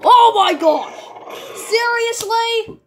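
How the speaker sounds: high, loud frustrated cry; shocked. Real, not acted. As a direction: yelp in disbelief. Caught by the enemy.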